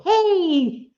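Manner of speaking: ordinary talk, nothing marked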